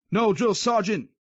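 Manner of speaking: slow shouted yes-sir answer; shouted reply. Acted. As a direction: sound off to the sergeant. Private answers loudly.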